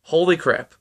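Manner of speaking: plain reading voice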